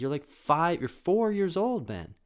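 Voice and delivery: mock exasperated parent; incredulous mom. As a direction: spell out the obvious to a small child. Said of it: "she was literally talking to us like that".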